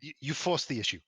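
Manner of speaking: gnome woman voice. Acted. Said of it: "the gnome woman goes".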